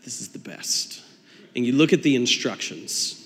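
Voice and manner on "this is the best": whispered mock delight; hushed sarcastic cheer. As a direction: breathe it out in fake joy. Mock delight over IKEA pieces.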